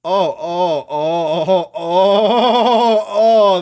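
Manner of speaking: loud, high wincing oh's; loud groaning oh's; pained outcry. Real, not acted. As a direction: recoil at the gore. Reacting to gory moment.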